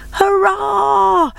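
very high joyful cheer. Acted. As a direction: cheer the winner home. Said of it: "the animals cheered".

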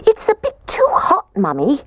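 tiny, very high-pitched voice; squeaky baby bear. A narrator's reading. Baby bear complains to Mummy, squeaking high. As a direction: squeak it like a little cub. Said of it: "The little baby bear squeaked".

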